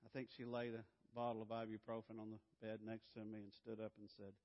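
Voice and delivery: normal talking voice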